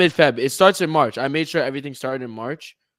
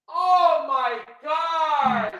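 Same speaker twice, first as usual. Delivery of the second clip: high, drawn-out cry of despair; anguished wail; 11 semitones higher, much slower than usual. Real, not acted. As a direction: wail it slowly over a lost round. Guessed wrong, losing reveal.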